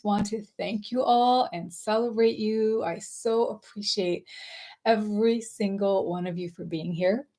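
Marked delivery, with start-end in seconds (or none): none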